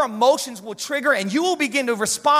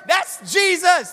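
emphatic; 7 semitones higher, 5 dB louder, much slower than usual. Real, not acted. Preacher stressing who she addresses.

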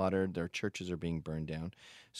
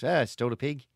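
bad Australian accent; 4 semitones higher, 6 dB louder, faster than usual. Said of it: "That's not a great Australian accent".

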